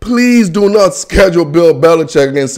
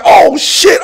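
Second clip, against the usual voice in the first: scared yell; 11 semitones higher than usual. Retold or imitated. Scared of heights on a roller coaster, panicked high yell. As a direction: yelp it like you're on a roller coaster. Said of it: "I'm gonna be like".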